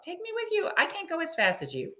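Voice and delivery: high little frog pleading. Acted. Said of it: "said the frog".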